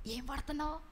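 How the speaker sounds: hushed whisper, barely voiced; gossipy whisper during the sermon; whispering in prayer. Shown as a demonstration. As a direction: whisper so no one in the pew hears. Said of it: "గట్టిగా మాట్లాడకూడదు కదా".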